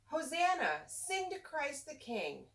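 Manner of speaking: ordinary delivery, nothing marked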